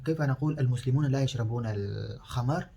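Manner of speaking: normal talking voice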